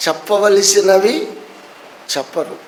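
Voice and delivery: ordinary talk, nothing marked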